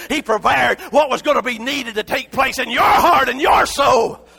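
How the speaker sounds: shouted, high preaching cry; full-force sermon shout; fiery preaching. Acted. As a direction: preach it to the rafters. Preaching build.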